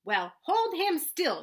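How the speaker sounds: slow, high, loud command; shouted. A narrator's reading. Mother shouting at her son to hold the goose, slow loud shout. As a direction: drag it out as a shouted order. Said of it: "she shouted".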